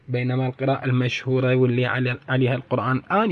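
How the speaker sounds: ordinary talk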